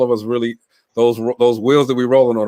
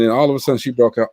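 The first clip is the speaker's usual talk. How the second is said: ordinary talk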